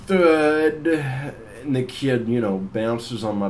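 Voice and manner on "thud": loud, higher-pitched drawn-out thud; imitated thud. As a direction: say it as the crash landing. Imitates landing on the ground.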